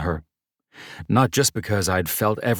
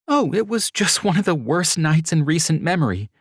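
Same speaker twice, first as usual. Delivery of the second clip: higher, louder woman's voice with a rueful edge; a tired young woman shrugging off a bad night; Mercedes, wry; 12 semitones higher, 4 dB louder than usual.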